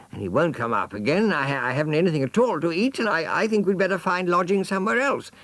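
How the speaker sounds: high, fast, complaining cub; whining jaguar son. Acted. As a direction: pour out the grievance in a rush.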